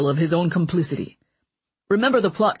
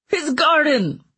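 higher, incredulous outburst; outraged; 10 semitones higher, slower than usual. Acted. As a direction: spit it out in disbelief. King outraged at the book.

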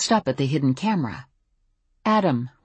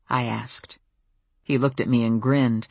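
plain reading voice

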